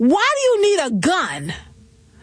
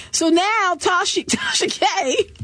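ordinary talk, nothing marked

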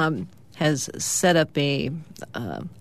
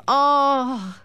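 high, drawn-out 'aww' of letdown; disappointed kid; 7 semitones higher than usual. A narrator's reading. Hammy hears his ball game is called off, acted disappointment. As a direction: whine it out like a let-down child.

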